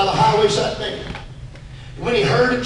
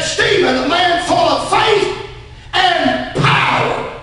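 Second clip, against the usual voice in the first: high, slow, ringing declaration; full-force preaching; 6 semitones higher, slower than usual. Real, not acted. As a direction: proclaim the verse, stretching the last word.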